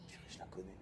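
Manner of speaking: breathless whispered aside, almost no voice; whispered recognition in the street; hushed aside. Retold or imitated. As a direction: breathe it to yourself in surprise. Recognising her, aside to himself.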